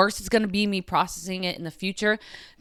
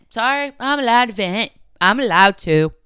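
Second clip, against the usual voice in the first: defiant; 3 semitones higher, 8 dB louder than usual. Real, not acted. Defiantly insisting on venting.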